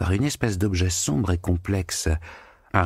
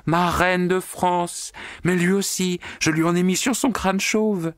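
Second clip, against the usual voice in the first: put-on drunk on flight and freedom; giddy maid; 12 semitones higher than usual. A narrator's reading. Natasha calls out while flying.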